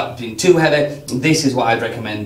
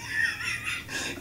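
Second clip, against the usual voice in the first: burst of laughter; 16 dB quieter than usual. Real, not acted. Host laughs at price joke.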